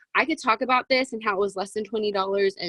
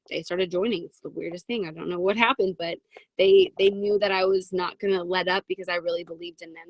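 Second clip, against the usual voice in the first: ordinary talk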